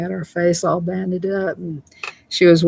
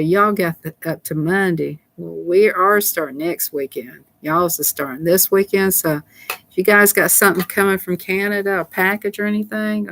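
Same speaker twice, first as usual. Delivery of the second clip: usual speaking voice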